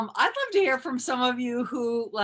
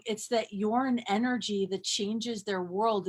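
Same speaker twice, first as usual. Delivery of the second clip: ordinary talk, nothing marked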